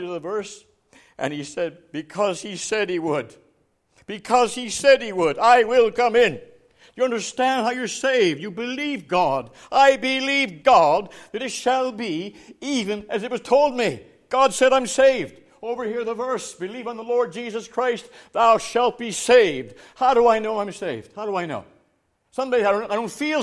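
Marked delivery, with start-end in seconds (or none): 4.1-5.4 louder, higher preacher's declaration; emphatic repeat. Retold or imitated. Answer repeated with preaching force. 5.4-19.7 loud, high, insistent pulpit delivery; full-force preaching. Retold or imitated.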